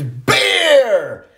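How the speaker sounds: high, acted scream down the slide; joyful scream. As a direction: scream out your drink order. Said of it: "and he screams".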